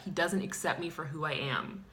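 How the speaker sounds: ordinary talk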